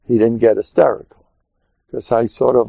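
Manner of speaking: ordinary talk, nothing marked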